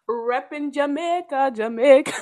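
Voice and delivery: hammed-up Jamaican accent, punching the last word; bad Jamaican accent. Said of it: "for my horrible accent".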